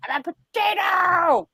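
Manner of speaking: high-pitched goofy exclamation; hammed-up, high joyful yell; silly mock shout. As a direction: belt it out high as a silly punchline. Retold reading it out loud, laughing.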